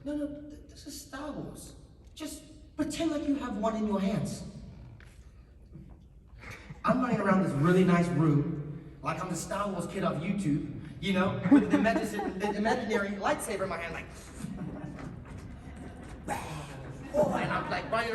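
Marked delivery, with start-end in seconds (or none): 0.0-4.4 mock persuasive lady, pitched up; bluffing casting director. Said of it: "she kind of bluffs me". 14.1-17.1 breathy mouth-made lightsaber swishes; playful imitated weapon whooshes; lightsaber noises. Miming an imaginary lightsaber.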